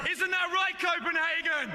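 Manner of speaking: high, hyped shout to an arena; rousing crowd call. Real, not acted. Host asks Copenhagen audience to back him up, rousing call. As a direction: throw it to the crowd for a roar.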